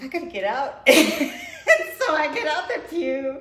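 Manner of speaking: high, loud laughing need to escape; laughing through the embarrassed exit; laughing urgency. Retold or imitated. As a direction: laugh while rushing out. Retelling rushing out of church.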